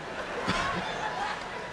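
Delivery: a high-pitched, breathy burst of laughter; high wheezing laugh. Real, not acted.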